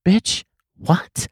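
a breathy, half-swallowed "Bitch, what?" in real shock; stunned disbelief. Real, not acted. To the clerk at Best Buy, stunned disbelief. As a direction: let it fall out of you, dumbfounded. Clerk demands curbside check-in.

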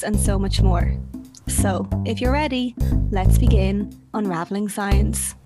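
upbeat announcer read over music; podcast intro read. Acted. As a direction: read it like a show opener.

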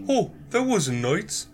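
high-pitched mock tattletale voice; Brad. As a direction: imitate a coworker telling on someone, pitched well up. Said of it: "Brad tells me".

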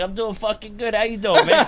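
high, loud, laughing Jersey greeting; hyped-up greeting. Retold or imitated. Guy in line greets him with a laughing, hyped-up howdy. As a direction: pump it up, laughing as you greet. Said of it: "He's like".

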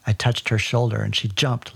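usual speaking voice

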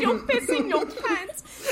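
slow strained taunt, laughing. Acted. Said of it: "That was really hard with my sick voice".

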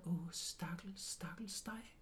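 soft whispered thought; pitying whisper. Retold or imitated. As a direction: whisper the pity to yourself. Thinking pityingly about clairvoyant.

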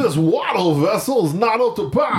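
hammed-up Georgian imitation; Georgian accent. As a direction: overdo the Georgian accent for laughs. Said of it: "that's how they sound".

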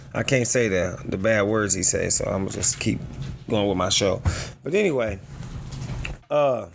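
ordinary talk

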